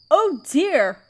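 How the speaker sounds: normal talking voice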